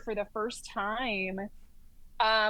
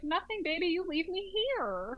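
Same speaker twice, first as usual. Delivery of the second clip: breezy sassy reply; 6 semitones higher than usual. Retold or imitated. Telling nurse to leave her.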